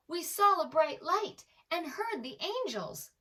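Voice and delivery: high, loud, eager telling; excited shepherds. A narrator's reading. Shepherds bursting into the stable, loud excited telling. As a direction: burst in with the wonderful news. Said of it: "they began".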